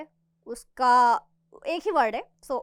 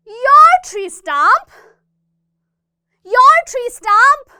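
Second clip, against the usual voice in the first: loud, slow, higher outraged question; angry demo; 8 semitones higher, 13 dB louder, much slower than usual. Shown as a demonstration. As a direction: demand it while jumping. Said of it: "fourth dialogue". (गुस्से में ज़ोर से)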